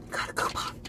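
breathy whispered echo. Real, not acted. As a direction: whisper it to yourself. Repeats coupon whispered.

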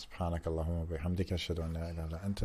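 quick, low, soft recitation; recited prayer. Real, not acted. Host closing the episode with a supplication, reciting quickly. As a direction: murmur a familiar prayer rapidly.

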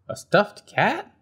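little bird hamming up disgust; horrified Bird. Acted. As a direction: act scandalised by the idea. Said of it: "said Bird".

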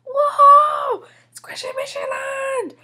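high shout of joy, wildly over the top; ecstatic outburst. Acted. Julian hears of the amusement park trip, ecstatic outburst. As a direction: explode with delight at the news.

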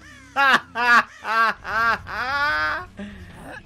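long loud villain cackle; evil laugh. Acted.

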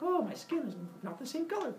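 mock dismay in a small high voice; bewildered townsfolk voice. People voiced in the story.

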